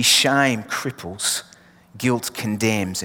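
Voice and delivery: ordinary talk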